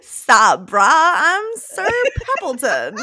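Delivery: loud, lazy bro introduction; frat bro. Pretend. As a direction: ham up a dude-bro drawl. Guinea pig bro introduces himself.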